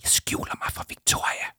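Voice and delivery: secretive voiceless whisper; hushed confession. Acted. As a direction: whisper from a hiding place. Hiding, whispers to Arthur.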